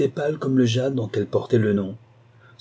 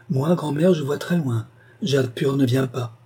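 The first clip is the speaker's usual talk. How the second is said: put-on higher, light child's voice; 3 semitones higher than usual. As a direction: play the little boy, eager. Child answers grandmother.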